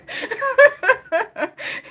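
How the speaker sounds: loud high burst of laughter. Real, not acted. Big laugh.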